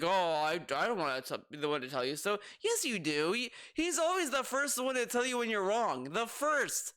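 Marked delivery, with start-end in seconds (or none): 0.0-2.4 mock innocent. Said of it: "he's acting as if".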